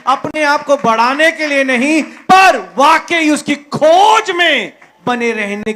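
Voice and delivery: preaching shout. Real, not acted. प्रचारक सभा से, ऊँची और ज़ोरदार पुकार में. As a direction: build to a fiery shout for the congregation.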